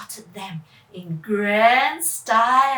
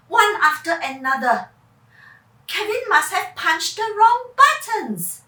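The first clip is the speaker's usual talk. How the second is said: usual speaking voice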